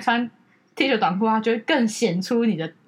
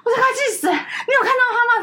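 higher, laughing mock fury; half-laughing, put-on rage with the pitch way up; fuming sister; 10 semitones higher than usual. Sister fuming at brother's logic, higher mock fury through laughter. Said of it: "气噗噗，就说".

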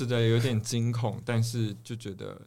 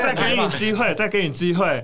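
higher and louder, playful mock warning; teasing; 9 semitones higher, 7 dB louder than usual. Real, not acted. Host playfully giving the guest a second chance, teasing. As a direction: tease her into taking it back.

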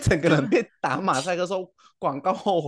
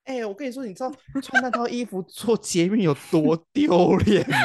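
ordinary talk, nothing marked

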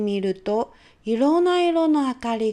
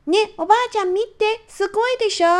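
put-on much higher, louder child calling out; excited child; 10 semitones higher, 4 dB louder, faster than usual. Little girl calling mom to see her castle, excited and high. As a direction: show off to mom, bursting with pride. Child calls grandma to look.

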